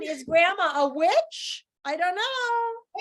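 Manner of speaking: high, slow spooky wonder; mock suspense. Real, not acted. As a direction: drag it out playfully spooky. Teacher plays up suspense.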